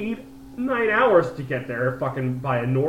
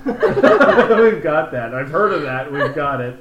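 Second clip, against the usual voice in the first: loud, slow, laughing Mexican waiter; waiter voice through laughter; 8 dB louder, much slower than usual. Retold or imitated. Friend retelling the waiter's answer about guacamole, laughing. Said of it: "he was like".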